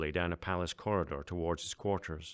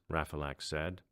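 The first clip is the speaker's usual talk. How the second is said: ordinary delivery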